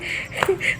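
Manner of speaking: laughing under her breath; breathy laugh. Real, not acted. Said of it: "इतनी हंसी आ रही थी".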